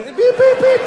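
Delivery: monitor beeps. Pretend. Systems failing.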